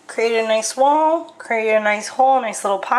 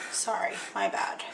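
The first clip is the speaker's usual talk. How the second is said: hushed aside, almost voiceless; quick whispered sorry; whispered apology; 3 semitones lower, 11 dB quieter than usual. Real, not acted. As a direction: breathe the apology under your breath. Apologizing for a bad pour.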